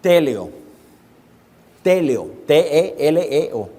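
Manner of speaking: "teleo" said slowly then spelled T E L E O letter by letter; Greek word spelled out. Shown as a demonstration. Preacher teaching the Greek word to the church, spelled out slowly. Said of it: "la palabra griega".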